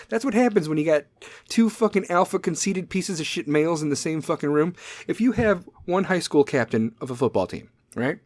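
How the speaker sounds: usual speaking voice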